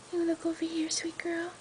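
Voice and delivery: soft soothing whisper. Real, not acted. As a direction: whisper gently to calm her. Calming a shivering pet.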